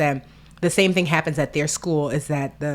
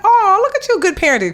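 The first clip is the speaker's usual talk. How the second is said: much higher, loud, gushing; gushing praise; 14 semitones higher, 5 dB louder than usual. Retold or imitated. Friend gushing over her sister's good parenting, high and loud. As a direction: gush over it like a proud fan.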